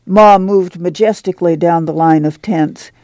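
ordinary delivery, nothing marked

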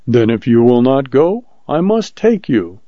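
plain reading voice

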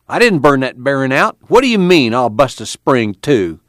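indignant, high-pitched protest; angry yelling. A narrator's reading. Al protests Tom's warning, angry and high. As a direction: fling the accusation back, pitched high. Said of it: "went red with anger".

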